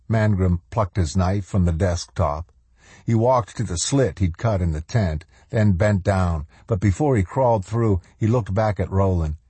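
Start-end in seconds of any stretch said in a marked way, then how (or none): none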